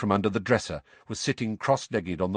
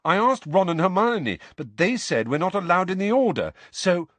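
put-on higher, louder teenage protest; a boy arguing his case; indignant young Harry; 10 semitones higher, 5 dB louder than usual. A narrator's reading.

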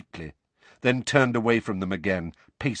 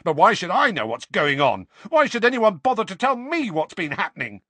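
high, loud, resentful shouting; angry outburst; 10 semitones higher, 5 dB louder than usual. A narrator's reading. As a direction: rant with raised pitch and volume. Harry's pent-up outburst goes on.

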